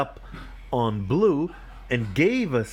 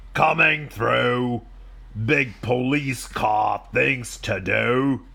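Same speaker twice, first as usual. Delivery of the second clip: slow, drawn-out police car voice, lightly acted; much slower than usual.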